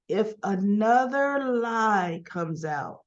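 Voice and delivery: drawn-out warning, word by word; slow retold warning. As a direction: stretch out the warning for weight. Retold warning, repeated slowly for weight.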